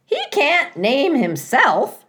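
high, loud, slow squawk; a goose scolding the robot. A narrator's reading. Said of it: "squawked Loudwing".

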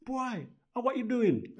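high mock voice of a puzzled dance partner; questioning girl. Girl questions his stiff dancing, imitated high voice. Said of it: "the girl would say".

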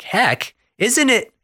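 high, confused squeak; puzzled Heifer. Retold or imitated. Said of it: "And he's like".

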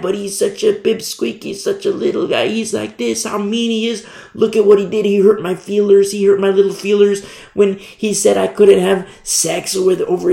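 high, whiny mockery of Paul's critics; whiny complaining heckler; mocking critic. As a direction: sneer like a petty accuser.

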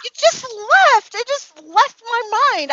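high, slow, frustrated whine; exasperated whine. Real, not acted. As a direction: whine that it slipped away. Can't recall the title.

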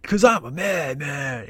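slow, strangled goofy madman voice; a man-child screaming that he's crazy, slowed down; Billy Madison voice. Retold or imitated. Rex trying to scare the hostages, in a slow goofy madman voice. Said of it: "the voice he did in, like, uh, Billy Madison".

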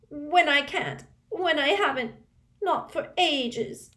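high, loud and slow wail; piercing voice. Acted. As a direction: wail it shrilly, stretching the end. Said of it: "her voice echoed loudly around the bathroom".

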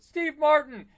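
shouted high and loud; triumphant game-show answer; excited reveal. Real, not acted. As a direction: burst out with the answer like a game host. Answering his own quiz question.

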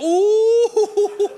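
high, loud wincing ooh with a laugh; crowd ooh. Retold or imitated. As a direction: react like boys seeing a bad hit. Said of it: "all the other guys are standing around going".

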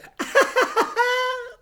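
very high fake cackle; mock a showy, high-pitched laugh; imitated Sasha laugh. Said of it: "Sasha on SmackDown who does the".

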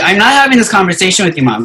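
high, loud, fast protest; retold indignant refusal. Acted refusal to a prying mom.

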